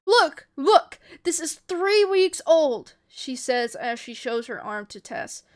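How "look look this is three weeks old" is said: loud, high, desperate proof; urgent insisting. A narrator's reading. Ellie showing Tess her arm, urgent insisting. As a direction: insist loudly, drawing out the end.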